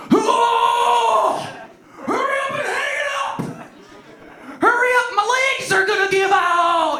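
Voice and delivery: high, strained mock cry for hurry; imagined straining girlfriend. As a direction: squeal it as if buckling under a weight. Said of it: "it wasn't like that at all".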